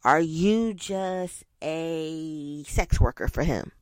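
slow hushed whisper. Acted. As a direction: drop to a breathy whisper. Rhetorical jab at listener.

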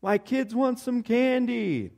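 high, drawn-out mock holler; mock yelling. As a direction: imitate yelling out for candy, without full volume. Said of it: "so I started yelling".

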